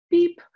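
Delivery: a high, loud spoken beep; censor bleep. Pretend. Covering her own insult, a high, loud bleep. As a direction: bleep out the swear word yourself. Self-censoring a swear word.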